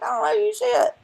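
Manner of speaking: high, scornful comeback; mock outrage, pitched high; indignant retort. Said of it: "I'm like".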